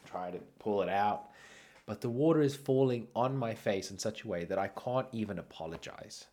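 normal talking voice

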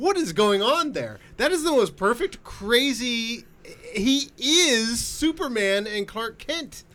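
high-pitched fan astonishment; awed gushing. Real, not acted. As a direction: gush in a high, amazed voice. Gushing over Reeve's perfect casting.

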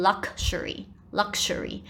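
luxury with a "k" and an "sh" in the middle; British pronunciation demo. Shown as a demonstration. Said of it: "这个是英国人的念法".

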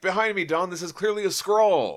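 high, loud protective cry; heroic warning. Retold or imitated. Surfer shielding Dawn from the double, high loud heroic call. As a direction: throw yourself in front of her.

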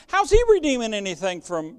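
ordinary talk, nothing marked

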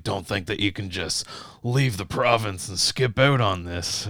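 lightly imitated Texas accent. Cop warning out-of-province drivers, light Texas accent. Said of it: "giving him a Texas accent".

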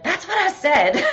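put-on indignant little girl. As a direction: insist like an offended little girl. Daughter insists she said it.

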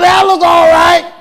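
high, shouted comeback; indignant high-pitched shout; loud defensive retort. Retold or imitated. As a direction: protest loudly, pitch way up. Acts out his defensive retort about suit.

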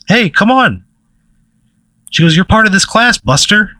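high, encouraging call to join in; coaxing gym teacher. Acted. Coach calls shy student over.

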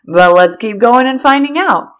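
loud eager husband. Retold or imitated. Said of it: "He's like".

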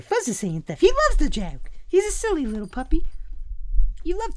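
high cooing pet voice. Real, not acted. Owner talking to his puppy Fuzz, high cooing voice. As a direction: coo at the little dog.

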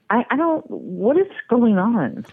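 high-pitched real exasperation; frustrated. Real, not acted. Customer demands answers about a canceled order, exasperated. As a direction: let the voice climb, then drag out the question. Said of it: "I'm really frustrated".

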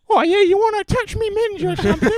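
loud, very high mock British girl. British girl walking into the pub, shrill mock accent. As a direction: screech it in a caricature British accent. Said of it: "British girl walks into the pub".

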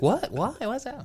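high, soft imitation of her; lightly imitated puzzled little questions. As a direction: ask it small and high, as if puzzled. Retelling her puzzled reply.

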